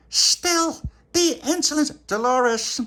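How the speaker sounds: very high, loud scandalised cry; outraged queen. Acted. Queen complaining of insolence to Dolores, shrieking high.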